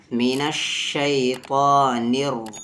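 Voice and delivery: من الشیطان الر- slowly, with thick T and heavy R; heavy sounds. Shown as a demonstration. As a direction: recite من الشیطان الر- drawn out, making T and R heavy. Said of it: "heavy نہیں ہوا". (من الشیطان الر- میں طا اور را بھاری)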